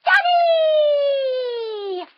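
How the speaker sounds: put-on high, loud child's cry of joy; excited baby fish shout; little fish calling dad. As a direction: call out like a small kid spotting dad. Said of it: "And he says".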